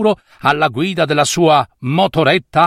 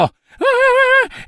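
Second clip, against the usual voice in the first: very high short shriek; imitated shriek. Skeleton mimicking the zombie's scream, high shriek. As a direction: squeal like he's chasing you.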